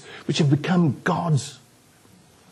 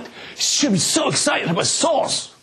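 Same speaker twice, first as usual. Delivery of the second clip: mock congregation voice; 9 semitones higher than usual. Pastor voicing the congregation's imagined reply, mock high shout. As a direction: play the crowd egging him on.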